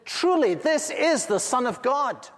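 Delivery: high and loud in amazement; astonished. Retold or imitated. Guard beside John seeing Jesus die, astonished and loud. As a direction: say it struck with awe. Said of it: "and was so astonished".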